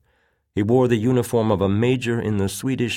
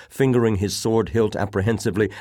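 plain reading voice

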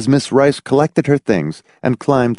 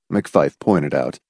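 plain reading voice

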